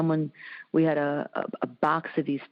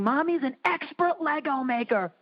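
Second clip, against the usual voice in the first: put-on higher, sing-song little girl praising; child's proud voice; 8 semitones higher than usual. Daughter praising her mom's Lego work, high childlike voice. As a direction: play the impressed six-year-old, higher. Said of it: "she kept saying".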